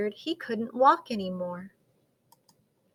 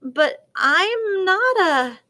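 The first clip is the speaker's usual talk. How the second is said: high, loud, drawn-out objection; protesting; 8 semitones higher, 6 dB louder, slower than usual. A narrator's reading. Gus begins to object to the penguins, protesting. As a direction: start a slow protest and break off.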